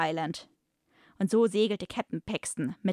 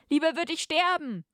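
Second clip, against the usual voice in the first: high, loud hammed-up hero's defiance; defiant cry; 10 semitones higher, 3 dB louder than usual. Captive captain defies the torturer, loud hammed-up cry. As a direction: fling it at the villain like a brave captain.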